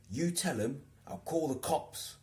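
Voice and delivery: high, slow mock threat; solemn mock warning; imitating Steve. Steve warning about the harasser, slow mock threat.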